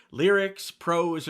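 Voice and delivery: ordinary delivery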